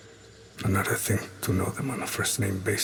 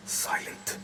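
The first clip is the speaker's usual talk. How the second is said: barely breathed, voiceless; whisper; 6 dB quieter than usual. Acted. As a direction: let the word die into a whisper. Lone assassin's haunted reflection.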